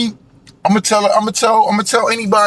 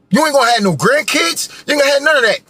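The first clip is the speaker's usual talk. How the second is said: raised, higher-pitched shout; 8 semitones higher, faster than usual. Real, not acted. Ranting at the listeners about their lost future, shouting. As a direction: let it burst out as a real yell of warning.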